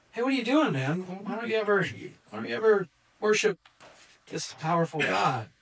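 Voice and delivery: lightly voiced baffled king questioning a friend; incredulous challenge. King asks Daniel why he never worships, mildly incredulous.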